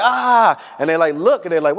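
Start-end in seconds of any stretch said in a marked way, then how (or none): 0.0-0.6 a quick high yelp; mock scared yelp. Retelling Jehoshaphat under attack, mock scared yelp. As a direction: yelp like a frightened king. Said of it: "he gets scared, He's like".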